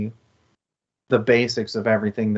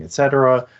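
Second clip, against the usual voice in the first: normal talking voice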